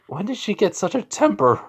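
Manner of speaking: high-pitched, mock-baffled complaint; exasperated wondering. Son thinks to himself after mom's outburst, exasperated. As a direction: wonder aloud as the put-upon son.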